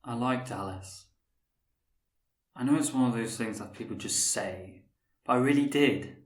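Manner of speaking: usual speaking voice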